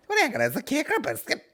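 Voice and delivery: very high, rushed silly voice; hammed-up, fast, high-pitched pestering; mock questioner. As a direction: fire off the question in a squeaky mock voice. Mocking a silly podcast question.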